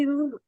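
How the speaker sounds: Ooh with the tongue against the teeth; imitating a wrong R. Teacher imitates a student's mistaken R sound. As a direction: mimic the learner's rolled R as Ooh. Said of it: "So you say".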